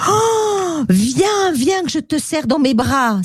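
high-pitched delighted cry; joyful storybook crocodile; Croque-en-Bouche, overjoyed. Acted. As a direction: exclaim happily, voice starting very high and easing down. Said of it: "s'écria Croque-en-Bouche tout heureux".

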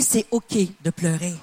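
tender permission to cry. Shown as a demonstration. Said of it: "dis-lui".

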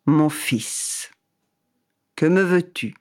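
calm, solemn, distant voice of God. Acted. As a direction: speak slow and grave, from above. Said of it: "Alors il entendit".

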